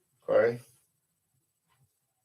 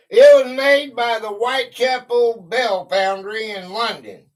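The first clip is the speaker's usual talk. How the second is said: raised, louder read-out voice; reading aloud; 6 semitones higher, 6 dB louder than usual. Real, not acted. Host reads a bell fact to live viewers, loud read-aloud. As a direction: announce the fact to everyone listening.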